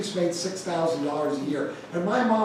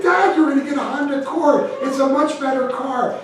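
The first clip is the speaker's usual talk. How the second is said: pleading with dad; 8 semitones higher, 6 dB louder than usual. Retold or imitated. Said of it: "I said".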